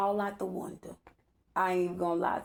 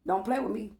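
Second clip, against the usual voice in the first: normal talking voice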